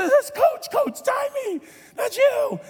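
very high-pitched, panicky imitation; flustered squeal. Player reads coach's name off his back, mock flustered squeal.

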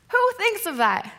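high-pitched incredulous question; amazed disbelief. Real, not acted. Preacher marvelling at Peter's idea, high-pitched disbelief. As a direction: lift the pitch right up in disbelief. Repeats the question, much higher.

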